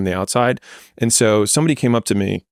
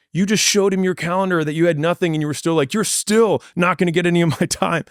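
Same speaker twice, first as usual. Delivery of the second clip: high, excited mock friend voice; imitating amused friend; 8 semitones higher than usual. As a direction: squeak it out like a delighted buddy. Friend retelling the story excitedly.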